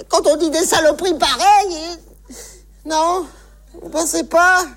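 usual speaking voice

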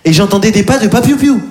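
ordinary delivery

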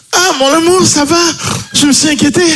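high-pitched anxious wife; caricatured fretting wife, high and fussy. Acted. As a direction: gush with worry in a high falsetto. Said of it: "Chérie, réveille-toi".

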